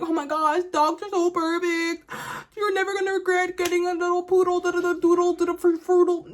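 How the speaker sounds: high gushing sing-song mock of dog lovers; mocking dog people. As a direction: parody a gushing dog person selling dogs. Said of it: "They're gonna just be like".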